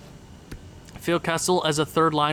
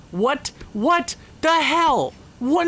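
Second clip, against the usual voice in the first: disbelief; 8 semitones higher, much slower than usual. Real, not acted. Reacting to a goal against.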